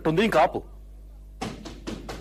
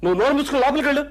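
furious shout; 9 semitones higher, faster than usual. Acted. Husband to wife, ordering her inside: furious fast shout. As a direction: bark her out of the room.

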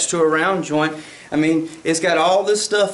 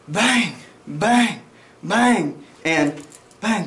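slow, spaced-out bangs; 3 semitones higher, much slower than usual. Shown as a demonstration. Instructor showing students slow recoil recovery, slow spaced bangs. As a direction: imitate slow gunfire, one drawn-out bang at a time. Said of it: "Hear the tempo?".

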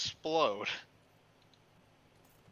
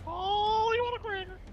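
much higher cooing voice for a bird; talking to a parrot. Pretend. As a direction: coo at the parrot like a pet.

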